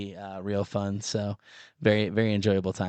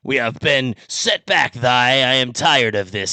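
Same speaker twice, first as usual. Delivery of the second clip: King James cat; 2 semitones higher, 5 dB louder than usual. Pretend. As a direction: proclaim it like a king in old English. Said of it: "like, King James".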